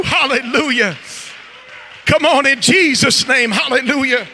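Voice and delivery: loud call-and-response preaching; rousing pulpit exhortation; fiery preaching. Real, not acted. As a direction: stir up the congregation. Preacher works up the congregation.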